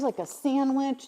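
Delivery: ordinary talk, nothing marked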